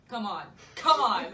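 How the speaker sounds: building to a loud insistent come on. Real, not acted.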